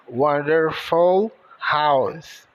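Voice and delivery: wonderful house said slowly, word by word; slow English demo. Shown as a demonstration. (wonderful house devagar, para o aluno repetir)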